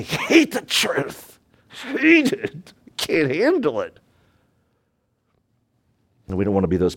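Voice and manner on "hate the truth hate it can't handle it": high, put-on sneer; mock sneering sinner voice. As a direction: sneer it like someone who despises the truth. Mocking voice of truth-haters.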